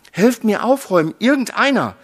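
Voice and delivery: loud, high, fast call. A narrator's reading. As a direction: urgently shout for help. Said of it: "rief er". (hastiges, lautes Rufen)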